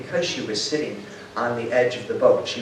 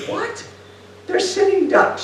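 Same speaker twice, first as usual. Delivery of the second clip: much higher, startled "What?"; incredulous protest; 3 dB louder than usual. Retold or imitated. Sniper protesting to her commander, high incredulous cry. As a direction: squeal the disbelief at the order.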